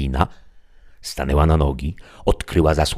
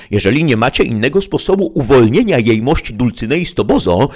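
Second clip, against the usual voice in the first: animated, mildly acted indignation, rising higher; indignant squire protesting; 9 semitones higher, 5 dB louder than usual. A narrator's reading. Sancho objects to Merlin about his backside, voiced with indignation.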